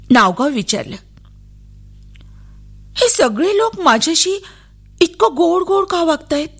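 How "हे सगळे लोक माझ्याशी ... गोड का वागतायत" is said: put-on high child's voice, wondering; young girl's bewildered inner voice; puzzled little girl. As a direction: ask innocently why everyone is so sweet. Girl wonders at officials' kindness.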